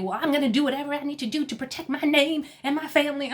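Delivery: fast, high-pitched imitation of defiance; hammed-up fighting talk; mock buck defiance. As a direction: rattle it off, mimicking someone puffing up. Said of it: "tries to get all buck".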